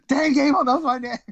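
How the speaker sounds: high and loud, thrilled; giddy fan squeal; delighted that his hero knows him; starstruck. Retold or imitated. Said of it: "I'm like".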